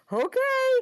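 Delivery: very high, loud squeak; mock-tearful high-pitched yelp; overwhelmed okay. Stunned reply, retold through tears.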